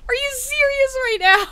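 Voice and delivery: high, loud half-laughing half-crying wail; anguished wail. Real, not acted. Player wailing as her baby drowns in the water.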